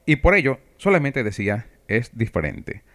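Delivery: normal talking voice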